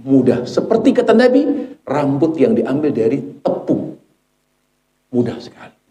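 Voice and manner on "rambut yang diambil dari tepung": ordinary talk, nothing marked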